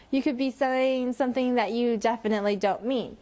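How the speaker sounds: ordinary talk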